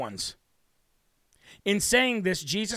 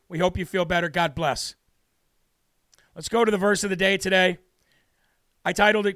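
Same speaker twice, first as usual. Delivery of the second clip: ordinary talk, nothing marked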